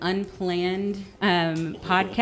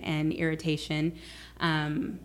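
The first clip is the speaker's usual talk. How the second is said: normal talking voice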